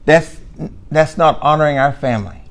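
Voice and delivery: soft, hushed mock reproach; sarcastic soft scolding. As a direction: murmur it with a sarcastic edge. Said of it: "And I'm being sarcastic".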